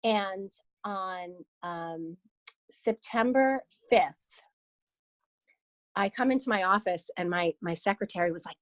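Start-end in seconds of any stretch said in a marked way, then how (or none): none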